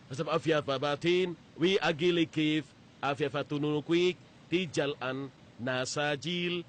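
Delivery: foreign phrase spelled out slowly, word by word; slow careful recital. Shown as a demonstration. To the hotel receptionist, reciting a learned Dinka phrase slowly.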